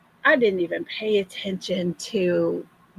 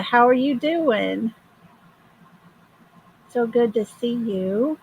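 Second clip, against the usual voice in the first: usual speaking voice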